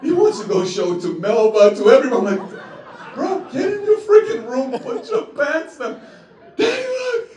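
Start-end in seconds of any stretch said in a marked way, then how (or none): 3.1-6.0 high, loud hammed-up scold; mock dad scolding. Father scolding toddler. 6.6-7.3 put-on very high, loud squeal of a little boy; excited toddler. Toddler son again.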